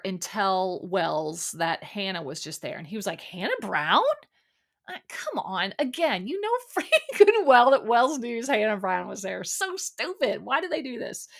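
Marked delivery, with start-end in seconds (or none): 3.2-4.0 incredulous question, sharply rising at the end; retold disbelief, pitch swooping up on the last word; mock surprise. Bartender reacting to news of a visitor, mock surprised. 4.9-11.3 high-pitched, exasperated rant; real disbelief at how dumb it is, pitched up. Real, not acted. Viewer calling out the show's staged reaction, exasperated.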